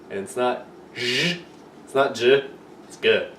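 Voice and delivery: "zh" and "j" rejected, then hard "g"; hard g sound. Shown as a demonstration. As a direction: contrast "zh", "j" with a hard "g". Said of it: "Like Greek".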